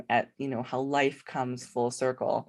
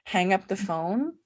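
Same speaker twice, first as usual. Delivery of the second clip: normal talking voice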